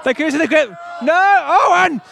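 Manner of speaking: animated commentary rising to shouts; live play-by-play. Real, not acted. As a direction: call each pull as if it were a final. Live tug of war commentary.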